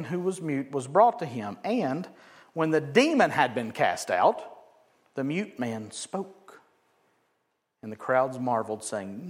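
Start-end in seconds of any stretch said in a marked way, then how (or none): none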